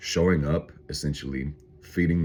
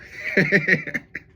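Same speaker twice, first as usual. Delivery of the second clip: high laugh; 5 dB louder than usual. Real, not acted. Laughing at own bit.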